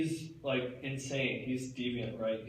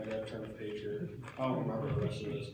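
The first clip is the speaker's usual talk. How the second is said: ordinary talk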